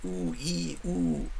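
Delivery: ordinary talk, nothing marked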